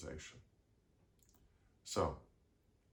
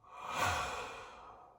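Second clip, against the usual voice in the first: one more long breath out; exhaling sigh. Shown as a demonstration. Guide repeating the breathing exercise, long relaxing sigh. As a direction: release a full, audible sigh. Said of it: "Once more".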